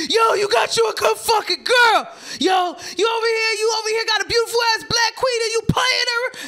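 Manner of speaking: high, loud, furious yelling at him; angry street shout. Retold or imitated. Bassie screaming at the boyfriend outside the bar, furious. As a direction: yell at him for playing his girl.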